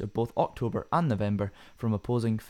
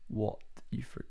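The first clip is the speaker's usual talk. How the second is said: whisper; 8 dB quieter than usual. Real, not acted. Trails off in a whisper.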